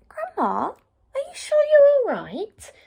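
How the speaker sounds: put-on worried little girl. Red questioning the figure in bed, worried high voice. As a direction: ask it like a doubtful child.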